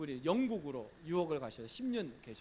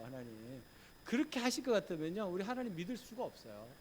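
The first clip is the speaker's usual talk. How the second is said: ordinary delivery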